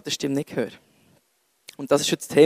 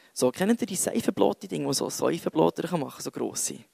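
ordinary talk

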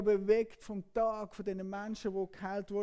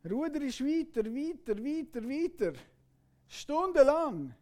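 ordinary delivery

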